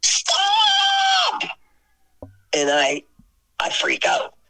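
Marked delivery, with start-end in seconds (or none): 0.0-1.4 very high, drawn-out scream; piercing mock-terrified shriek; imitated scream. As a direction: scream one long, desperate word. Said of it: "he screams so loud, so treacherously".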